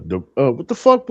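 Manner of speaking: ordinary talk, nothing marked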